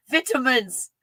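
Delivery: vitamins with a British short i, VIT-a-mins; light mock British vitamins; British accent. Shown as a demonstration. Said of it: "I switched to British".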